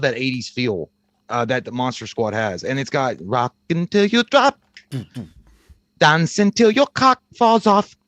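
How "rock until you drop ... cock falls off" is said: mock rock anthem. Quoting Monster Squad song line.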